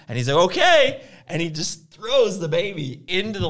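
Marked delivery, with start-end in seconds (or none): 0.5-1.0 high, loud one-word 'fine then'; mock compliance. Husband about to throw fake baby, mock compliant. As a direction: toss it out high and loud, calling the bluff.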